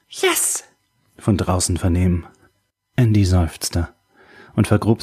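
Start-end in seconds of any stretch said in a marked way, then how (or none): 0.1-0.6 high, airy cheer; jubilant. A narrator's reading. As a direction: exult as if overheard from outside. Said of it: "ein jubilierendes".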